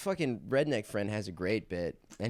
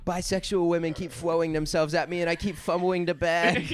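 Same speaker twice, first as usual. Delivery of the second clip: higher, louder put-on Batman; 9 semitones higher, 5 dB louder than usual. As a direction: complain to the Riddler like a helpless hero. Batman voice in acted bit.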